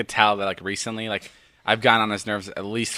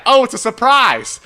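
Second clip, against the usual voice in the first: mocking imitation; 11 semitones higher, 7 dB louder than usual. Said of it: "That's what you said out loud".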